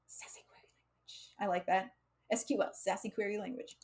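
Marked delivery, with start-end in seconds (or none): 0.1-1.3 breathy unvoiced whisper, very soft. Real, not acted. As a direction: whisper it to yourself as an aside. Muttering the joke name aside.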